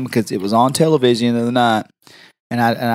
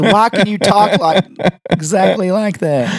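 slow, higher incredulous imitation; mock disbelief; 6 semitones higher than usual. Receptionist asking about the voice, mock disbelief. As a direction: stretch each word in mock amazement.

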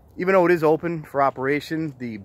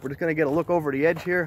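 ordinary talk

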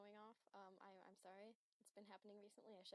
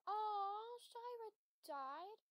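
mock grief; 10 semitones higher, 10 dB louder, much slower than usual. Finding a pet's grave.

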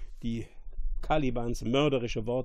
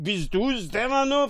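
high, raised voice of amazement; equally astonished; 9 semitones higher, 4 dB louder than usual. A narrator's reading. Fool recognising his lost friend, astonished. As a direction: ask it in loud disbelief. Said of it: "nicht minder erstaunt".

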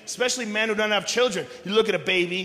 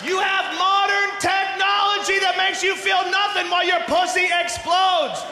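exasperated rant; 9 semitones higher than usual. Acted. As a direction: push it high, incredulous and exasperated. Ranting for modern medicine.

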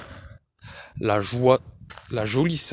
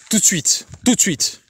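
higher, sputtering repeat in fury; stammering, beside himself; 7 semitones higher than usual. A narrator's reading. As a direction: spit it out twice, losing control. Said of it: "bégaya Ferraud, hors de lui". (bégaiement furieux, plus aigu, hors de lui)

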